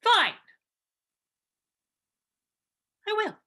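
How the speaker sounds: loud, high, curt retort; angry. Real, not acted. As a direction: bite back, fed up. Snapping back in the quarrel.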